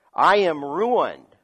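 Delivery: slow, loud despair; despairing cry. Acted. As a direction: let the ruin ring out slowly. Isaiah's despair in reading.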